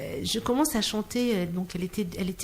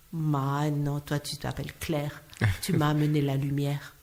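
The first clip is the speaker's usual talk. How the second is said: voix douce et basse; dis-le tendrement, plus grave, comme une confidence; réponse tendre; 5 semitones lower than usual. Retold or imitated. Rejoue la réponse tendre de la maman. (tendre et posé, tout bas)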